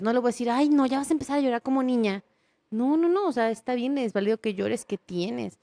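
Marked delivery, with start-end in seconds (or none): 0.5-2.2 a scornful parent brushing off tears, pitched up; mock the boy for crying; impatient, belittling parent voice; dismissive parent. Parent scolds a crying son.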